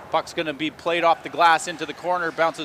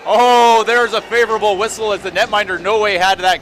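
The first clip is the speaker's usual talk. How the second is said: higher and louder, calling a close save live; excited call; 5 semitones higher, 7 dB louder than usual. Real, not acted. Play-by-play caller reacting to a near goal, excited. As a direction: ride the rush of a scramble at the net. Close shot, favorable whistle.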